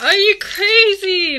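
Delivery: loud, slow, disbelieving; can't believe it; incredulous outburst. Real, not acted. Exasperated outburst.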